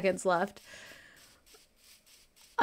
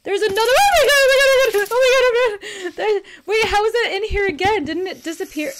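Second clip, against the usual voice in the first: panicked shrieks, much higher and louder; terrified screaming; 9 semitones higher, 7 dB louder than usual. Real, not acted. Streamer shrieks at the monster reappearing, in real panic. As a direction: scream in real terror, half laughing.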